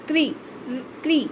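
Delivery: क्री said as one syllable, k straight into ri; kri pronunciation. Shown as a demonstration. Teacher showing how to pronounce the sign, demonstrated क्री. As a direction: say क्री twice, separately, with a clear r. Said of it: "इसको कैसे pronounce किया जाता है".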